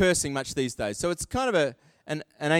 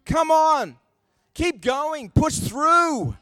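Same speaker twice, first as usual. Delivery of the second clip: rising, rallying call to push on; urging on; 9 semitones higher, 4 dB louder, much slower than usual. Acted. Preacher rallying the congregation, loud and high. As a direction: spur the crowd on like a coach.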